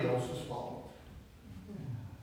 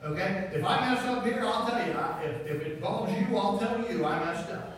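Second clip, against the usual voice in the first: preaching at full force; 6 semitones higher, 4 dB louder than usual. Real, not acted. Preacher urging the congregation to admit mistakes, fervent.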